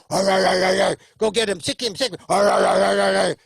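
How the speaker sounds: loud growling arr arr; bulldog growl. Retold or imitated. Owner sets bulldog on someone, loud mock growls. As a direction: growl and egg the dog on. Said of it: "then you go".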